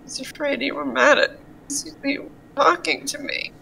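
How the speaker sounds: soft, rushed admission; timid confession. Acted. Gil admitting worry to his friend, timid and hushed. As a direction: confess it quickly and quietly.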